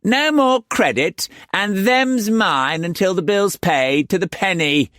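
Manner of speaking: high, final, imperious; madam voice. A narrator's reading.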